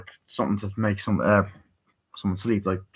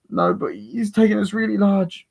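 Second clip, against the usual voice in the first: high-pitched mock-innocent girl; put-on high girlish voice; imitated girl; 11 semitones higher than usual. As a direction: play a girl pleading her story. Voicing the girl's pleading story.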